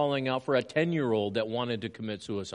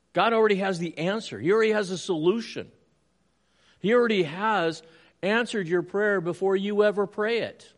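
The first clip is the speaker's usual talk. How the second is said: higher, louder, "already" stressed each time; mind-blowing news with "already" hit hard; excited proclamation; 7 semitones higher, 4 dB louder than usual. Real, not acted. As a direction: announce it like great news. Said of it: "I wanna blow your mind".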